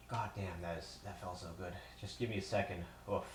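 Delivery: quiet, out-of-breath relief with little puffs; softly puffed out between short phrases; winded. Real, not acted. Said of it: "I'm a little bit winded".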